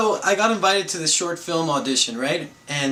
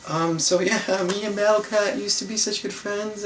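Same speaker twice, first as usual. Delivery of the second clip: put-on higher-pitched, childlike reminiscing; regressed to childhood; 7 semitones higher than usual. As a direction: retell it like a kid rambling about his pet. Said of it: "I regress to my childhood days".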